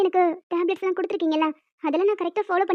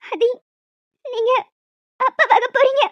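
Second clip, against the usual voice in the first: breathless announcement, a little louder; first telling; 2 semitones higher, 4 dB louder than usual. Acted. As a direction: break the news to him, voice trembling with joy. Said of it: "மறுபடியும் சொல்லு". (உற்சாகத்துடன் முதல் முறை சொல்வது)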